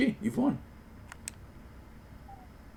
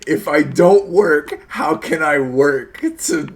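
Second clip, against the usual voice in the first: loud, slow, incredulous mock quote; mock exasperated rant; 4 semitones higher, 9 dB louder, slower than usual. Streamer mocking the rule to his friend, incredulous. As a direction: act out the baffled worker. Mocking the absurd work logic.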